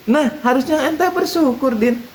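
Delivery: put-on high, insistent old cleric; kiai voice. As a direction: press the point like a wise kiai. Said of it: "Kata Pak Kiai". (kiai menegaskan, nada tinggi)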